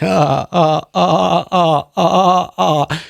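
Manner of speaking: repeated short "uh" grunts; mock hammer-swing grunts. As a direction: grunt along with each pretend hammer blow. Acting out hitting people with hammers.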